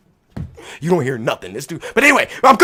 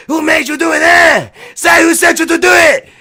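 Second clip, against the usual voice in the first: higher, louder interrogating shout; angry demand; 6 semitones higher, 4 dB louder than usual. Retold or imitated. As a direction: bark it like you want a confession. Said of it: "he was like".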